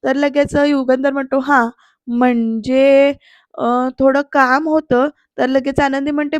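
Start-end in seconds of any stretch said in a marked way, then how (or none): 1.4-5.1 slow, drawn-out stalling; hesitant. Retold or imitated. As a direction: stall and stretch the words, feeling for what to say. Hesitant boss approaches Anandi for favour.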